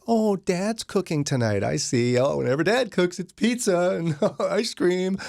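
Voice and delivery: high, drawn-out mock condescension; mimicked store clerk. Dad retelling the store clerk's remark, mock patronizing. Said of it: "the woman behind the counter was like".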